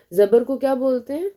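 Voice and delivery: ordinary talk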